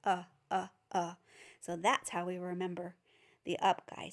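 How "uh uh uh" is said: "uh" said plainly and short; gentle "uh" for the class; short uh sound. Shown as a demonstration. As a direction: model the "uh" sound. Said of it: "I touch my nose and I look up".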